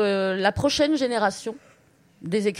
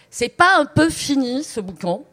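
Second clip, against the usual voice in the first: angry neighbour; 3 semitones higher, 7 dB louder than usual. Acted. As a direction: call out like a fed-up neighbour. Irate neighbours voiced in story reading.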